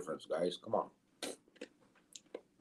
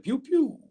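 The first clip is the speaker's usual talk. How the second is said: high laser pew pew; pew pew sound. Retold or imitated. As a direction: zap it like a toy blaster. Reading chat sound effect.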